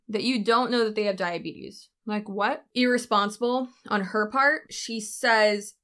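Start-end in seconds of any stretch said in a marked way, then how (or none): none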